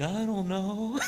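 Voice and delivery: small, high, soft voice; imitating upset student. Jamie to teacher in the hallway, imitated small upset reply. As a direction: answer meekly, as the kid in trouble.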